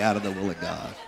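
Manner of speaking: "out of the will of God" repeated softly through a chuckle; half-laughing afterthought. Pretend. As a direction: take the bite out with a laugh. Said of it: "I say".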